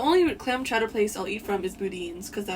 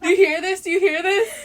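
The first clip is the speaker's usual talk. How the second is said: high, loud laughing tease; laughing while calling out; teasing; 10 semitones higher, 8 dB louder than usual. Real, not acted. Friend teasing her over a crush, laughing high.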